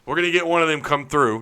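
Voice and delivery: usual speaking voice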